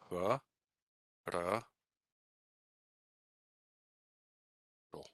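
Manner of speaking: "ha, ra, ro" as separated syllables huh, rah, SHO; "ha, ra, ro" with only the final vowel a full O; reduced vowels. Shown as a demonstration. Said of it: "Only the final O is fully pronounced as an O".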